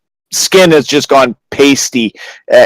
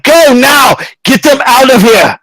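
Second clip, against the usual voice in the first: high, strained yell; desperate command; 10 semitones higher than usual. Acted. Magistrate sending the adventurer off to save his family, desperate yell. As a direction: cry out an urgent order. Magistrate orders them away.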